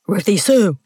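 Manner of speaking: a mild bellow, reined in; man's angry call, lightly voiced. A narrator's reading. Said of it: "Hamish bellowed".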